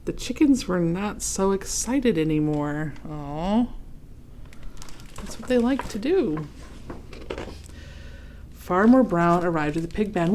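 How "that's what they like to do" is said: ordinary delivery, nothing marked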